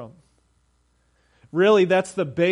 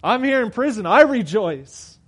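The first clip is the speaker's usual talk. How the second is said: loud, higher defiant joy; triumphant Paul; 3 semitones higher, 5 dB louder than usual. Acted. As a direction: proclaim it from the prison cell. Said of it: "here's Paul from prison saying".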